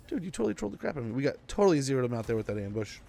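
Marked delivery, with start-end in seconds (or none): 0.1-1.1 fast, high-pitched mock outrage; exasperated retold complaint. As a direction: rattle it off like you just got pranked. Retold complaint to trolling friend.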